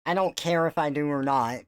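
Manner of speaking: ordinary talk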